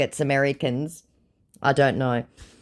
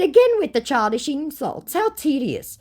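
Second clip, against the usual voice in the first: haughty, lofty and bored; snooty high voice; 13 semitones higher than usual. Retold or imitated. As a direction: sneer it down from up high. Her own retort, acted.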